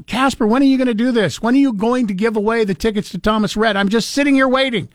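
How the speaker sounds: whiny impatient listener voice. Retold or imitated. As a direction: act out an antsy caller.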